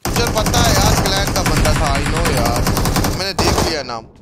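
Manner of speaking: loud, heated protest; loud exasperated. Real, not acted. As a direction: argue it loudly. Heated argument over the game.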